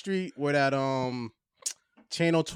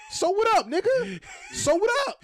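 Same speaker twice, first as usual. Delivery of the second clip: high, taunting imitation of a guy squaring up; mock street challenge. Retelling a teen daring another to fight, high taunting. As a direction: throw the challenge like you're ready to fight.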